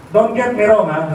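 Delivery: usual speaking voice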